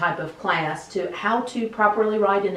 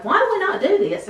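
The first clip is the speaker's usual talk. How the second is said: much higher, fast puzzled question; quoting a puzzled colleague; 13 semitones higher, 3 dB louder, faster than usual. Retold or imitated. Teacher quoting colleague Miss Champion asking about the pledge, high quick puzzled question. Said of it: "Miss Champion was one who was like".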